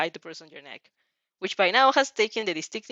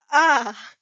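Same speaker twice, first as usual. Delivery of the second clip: loud, higher gasp of realization; sudden realization; 5 semitones higher, 8 dB louder than usual. A narrator's reading. Girl realizing she lost her red feather, alarmed. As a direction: it hits you all at once.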